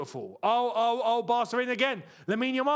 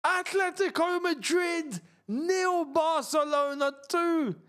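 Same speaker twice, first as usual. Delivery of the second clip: high, slow score call; excited announcing; 9 semitones higher, slower than usual. Real, not acted. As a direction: spell out the scoreline in delight. Announcing the score after a goal.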